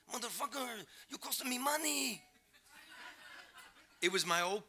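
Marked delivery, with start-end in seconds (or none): 0.1-2.2 high-pitched hammed-up yell, not much louder; mock angry shout. Coyote cursing the reporter from his boat, high mock shout. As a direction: holler it high like an outraged smuggler. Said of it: "giving me the finger and shouting".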